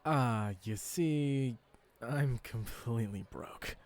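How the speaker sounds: put-on very slow, stalling young customer; sheepish admission. A narrator's reading. Customer confessing to the fruit seller he is broke, sheepish. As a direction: drag it out awkwardly before confessing. Hero admits he cannot pay.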